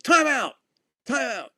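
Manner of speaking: high, loud acted-out timeout call; sudden high shout, retold. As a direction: call it out across a ball field. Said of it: "I called timeout".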